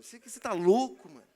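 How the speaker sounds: can't believe what he just said; shocked disbelief. Retold or imitated. Telling his friend he insulted the girl, shocked and loud.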